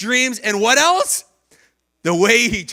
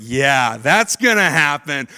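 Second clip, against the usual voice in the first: sarcastic; 6 semitones lower, slower than usual. Retold or imitated. Brothers' sarcasm.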